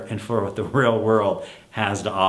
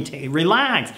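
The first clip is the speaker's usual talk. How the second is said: relax swooping much higher, playful; chill out; 7 semitones higher than usual. Real, not acted. Telling frantic parents to relax, playful high swoop. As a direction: tease the worried parent into calm. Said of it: "calming frantic parents".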